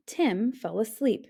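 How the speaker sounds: ordinary delivery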